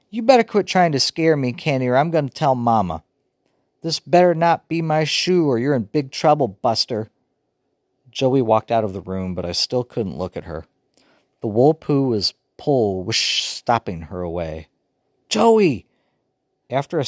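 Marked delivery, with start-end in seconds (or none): 0.1-7.1 annoyed threat. A narrator's reading. Joey threatening her brother. 15.3-15.9 calling loud and high. A narrator's reading. Brother calling his sister back, loud.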